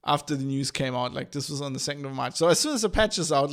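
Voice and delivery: normal talking voice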